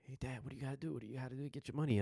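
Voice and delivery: imitating excited kids. Acts out sons urging dad.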